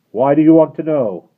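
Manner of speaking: loud high challenge; guard shouting at the rider; shouting back. Acted. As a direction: yell back suspiciously.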